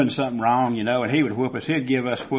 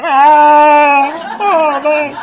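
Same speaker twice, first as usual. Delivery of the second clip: put-on scared kid; 13 dB louder, much slower than usual. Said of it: "and I was going".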